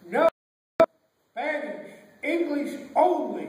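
loud, slow, spaced-out command; teacher's yell. Acted. Teacher banning Spanish in class, yelling. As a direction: bark each word at the class. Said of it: "the teacher finally yelled".

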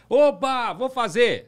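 acted-out eager whoop; mock celebration. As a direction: cheer as if you just got the job. Acts out premature celebration.

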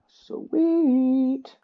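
delighted squeak. Real, not acted. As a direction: squeak it with glee.

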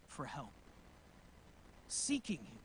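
normal talking voice

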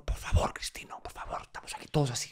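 hushed, pleading whisper; urgent whisper. Retold or imitated. Father hushing the grandfather at the wake, whispered. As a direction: shush him without being heard.

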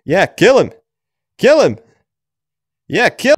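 high, loud eager cheering; excited yelling. Real, not acted. Cheering on a buddy to kill the mob, excited yell. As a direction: egg your buddy on to kill it.

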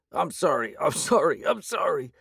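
high, desperate repeated sorry; begging. A narrator's reading. Thug begs the hero while his arm is twisted, desperate. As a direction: plead through the pain. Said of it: "he begged".

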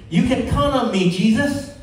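high, loud, cocky assurance; boasting. Acted. Peter assures Jesus of his loyalty, boasting. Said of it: "Peter was boasting".

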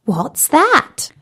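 put-on surprise pitched right up like a small child; Tim the tadpole, puzzled. A narrator's reading. Tim the tadpole to Bertie, a high puzzled squeak. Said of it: "said Tim".

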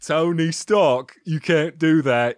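a clumsy British impression, stretched; bad British accent. Guest replays his impression for the kid, bad British accent.

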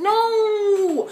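high, loud drawn-out "no"; emphatic no. Acted. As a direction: cut them off with a long, high no. Emphatically rejecting the imagined question.

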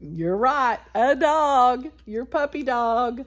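usual speaking voice

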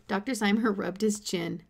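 ordinary delivery, nothing marked

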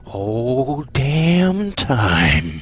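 "Whole damn time" stretched very slowly, each word drawn out; dragged-out repeat. Real, not acted. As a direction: drag "damn" out in bitter disbelief. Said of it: "how angry I am at myself".

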